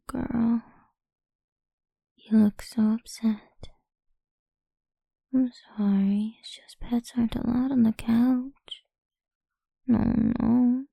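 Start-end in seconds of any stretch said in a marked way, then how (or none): none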